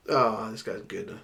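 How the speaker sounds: ordinary talk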